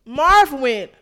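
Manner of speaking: loud and very slow; savoring the punchline, loud and slow; dramatic emphasis. Real, not acted. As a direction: drag it out for effect.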